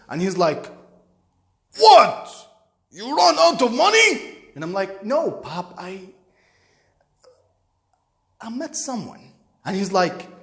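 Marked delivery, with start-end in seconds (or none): 1.8-4.4 loud, high, exasperated demand; pissed-off father. Retold or imitated. Father losing patience with his son on the phone, acted anger. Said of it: "was getting pissed". 5.0-9.3 halting confession with a long hesitation; nervous. Retold or imitated. Son confessing to his angry father, nervous and hesitant. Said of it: "Then I start sound like we nervous".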